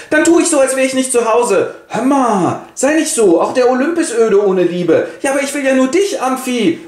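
high, dramatic wife's voice; Alkmene voice. Acted. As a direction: throw yourself into mock despair, pitched high. Alkmene pleads with Jupiter. (hohe, theatralisch verzweifelte Frauenstimme)

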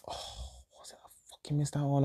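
fast, soft whispered muttering; hushed whisper while trying to remember; whispered to self. Real, not acted. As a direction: mutter it under your breath. Muttering to himself, trying to recall.

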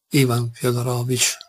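plain reading voice

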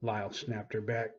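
ordinary delivery

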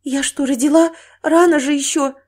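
high, loud alarmed question; panicked disbelief from a new mother; frightened. Acted. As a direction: blurt it out in dread. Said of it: "напал дикий страх".

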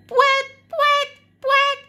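high loud honked pouet; honking trumpet alarm. Acted. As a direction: blare it like a stuffy trumpet. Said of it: "la trompette sonne l'alerte".

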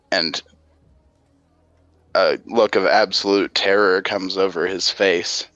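ordinary talk, nothing marked

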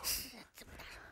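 hushed whisper outside the delivery room. Acted. Father told to hush near the delivery room, whispering. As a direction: barely breathe the words. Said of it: "爸，你小点声。".

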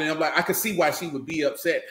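ordinary talk, nothing marked

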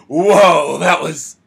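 loud, high, amazed outburst; excited whoa. Retold or imitated. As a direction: blurt it out like you just saw something amazing. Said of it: "I was like".

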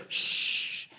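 sharp unvoiced shush; hushing shh. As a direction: silence them with a hiss. Culture silencing Christians.

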